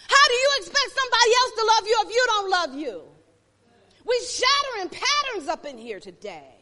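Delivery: loud, high, driving sermon cry; preacher pushing the congregation hard, loud and high; preaching at full force. Acted.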